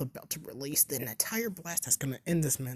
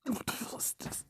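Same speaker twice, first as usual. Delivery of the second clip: whispering; 8 semitones higher, 4 dB quieter than usual. Retold or imitated. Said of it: "Mizuki would be like".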